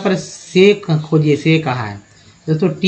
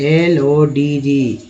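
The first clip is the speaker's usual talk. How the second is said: slow spelling; 2 semitones lower, much slower than usual. Shown as a demonstration. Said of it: "word ले लेते हैं लॉज".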